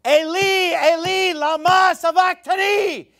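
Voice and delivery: high, loud cry of anguish from the cross; shouting to God in agony. Retold or imitated. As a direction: cry it out at the top of your lungs. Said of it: "Jesus cried with a loud voice".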